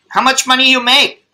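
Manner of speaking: a teasing take on a Thai taxi driver; mock Thai accent. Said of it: "You sound like a Thai".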